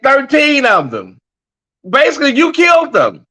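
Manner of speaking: high, hammed-up yell of the heckler; mock heckler shout. Vlogger imitating the heckler's outburst, mock shout. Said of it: "She said".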